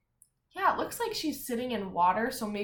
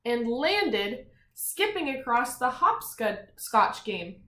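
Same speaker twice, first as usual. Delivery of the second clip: lively read-aloud voice, a bit higher and louder; storybook reading with energy; story narration; 4 semitones higher, 7 dB louder, slower than usual. A narrator's reading. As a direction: read it out for the kids. Reads story to children.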